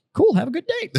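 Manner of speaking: high-pitched imitation of the kid; retold chipper brush-off. Retold kid's cheery brush-off.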